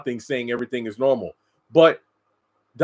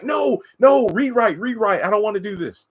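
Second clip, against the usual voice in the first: whiny refusal; 8 semitones higher, 3 dB louder than usual. Retold or imitated. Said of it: "K J was like".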